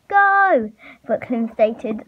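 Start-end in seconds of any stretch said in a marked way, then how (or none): 0.1-0.7 high, loud starting shout; shouted command. Acted. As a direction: shout it to launch the runners. Launching the plan by walkie-talkie.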